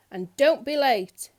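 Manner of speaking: loud, slow, bossy order; bossy shout. A narrator's reading. Miss Bossy shouting her tea invitation down the phone, loud and bossy. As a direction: bark it down the phone. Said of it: "she shouted down the phone".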